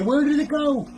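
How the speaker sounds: very high-pitched comic disbelief; acted bewilderment. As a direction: wail it in mock bafflement. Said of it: "You're sitting here going like".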